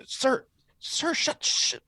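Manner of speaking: high, hissed hushing of a sobbing customer; mock shushing. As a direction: shush him in an urgent, breath-only hiss. Agent hushing a crying passenger.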